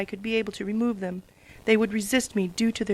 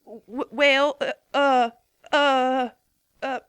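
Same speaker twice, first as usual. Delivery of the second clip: flustered higher stammer; 4 semitones higher, 4 dB louder, much slower than usual. Acted. Applejack caught out by Twilight's question, stammering. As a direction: stumble over the words in embarrassment. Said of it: "Applejack stammered".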